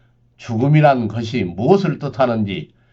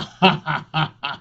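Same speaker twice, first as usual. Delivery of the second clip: mocking laugh; 4 semitones higher than usual.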